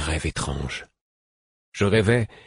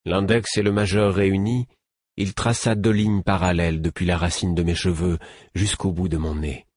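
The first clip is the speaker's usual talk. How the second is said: usual speaking voice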